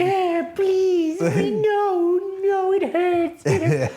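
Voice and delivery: put-on very high, slow, pleading old woman; whimpering grandma. Mimicking the fallen grandma's very high, pleading whimper. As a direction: whine like a hurt grandma. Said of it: "the grandma's fucking laying there".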